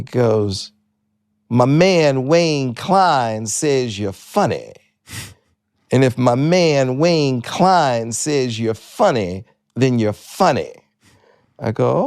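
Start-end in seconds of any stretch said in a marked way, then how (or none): 1.5-10.7 slow, drawn-out caller with swaggering emphasis; Jimmy J J Walker. Retold or imitated. Star comedian phoning a young comic, grand pronouncement. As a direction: stretch every word like a showman. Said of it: "This is Jimmy J J Walker".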